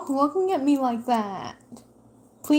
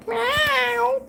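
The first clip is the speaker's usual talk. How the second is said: cat meow; 13 semitones higher than usual. Pretend. Meowing at the cat.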